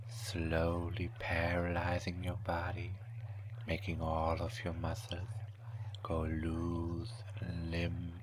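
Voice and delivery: normal talking voice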